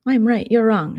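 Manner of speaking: loud mock comeback; mock yelling. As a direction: imitate someone yelling back in a quarrel. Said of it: "I'm gonna stop yelling".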